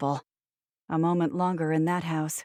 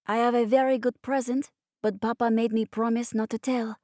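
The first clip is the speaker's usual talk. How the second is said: put-on higher-pitched little boy with French accent; lilting French accent; 5 semitones higher than usual. A narrator's reading. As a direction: confide like a small French-accented child. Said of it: "he'd whispered in his lilting French accent".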